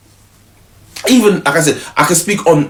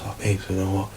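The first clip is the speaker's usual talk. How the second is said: much softer, lower sorry; soft apology; 5 semitones lower, 10 dB quieter than usual. Retold or imitated. Girlfriend quietly apologizing to her boyfriend upstairs. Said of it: "She goes".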